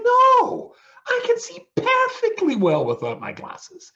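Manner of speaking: very high, loud mock outburst; indignant shout. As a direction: shout back in exaggerated indignation. Retold outburst at a stupid question.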